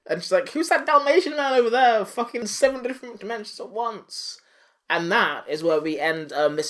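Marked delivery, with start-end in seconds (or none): 0.5-4.4 high, hammed-up confused cry; pitched-up imitation of a baffled woman; mock bewilderment. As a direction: call it out high in mock alarm. Voices MJ bewildered in the vortex.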